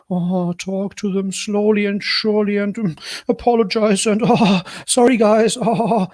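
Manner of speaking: high, whiny mock apology; mock apologetic voice. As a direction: ham up a timid sorry voice. Mocking apologetic vloggers.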